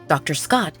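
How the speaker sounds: ordinary delivery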